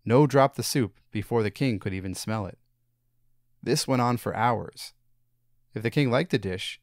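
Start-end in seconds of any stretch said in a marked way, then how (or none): none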